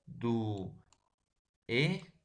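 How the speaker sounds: "Du, e" broken apart with long gaps; wrong syllable split. Shown as a demonstration. Teacher shows what others wrongly do, "Du, e" as separate pieces. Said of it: "la palabra duende así".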